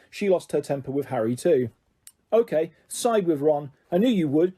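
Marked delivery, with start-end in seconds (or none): none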